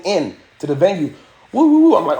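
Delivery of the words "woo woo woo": high, loud siren wail; wailing "woo woo" siren noise; imitated police siren. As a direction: whoop like police cars rolling in. Imitates arriving police car sirens.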